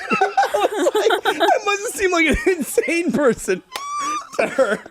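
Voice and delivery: high, slow laugh-squeal into the words. Real, not acted. Admitting to cohosts how crazy he seemed, laughing high. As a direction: let the laugh carry the words.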